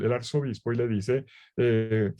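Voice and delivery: normal talking voice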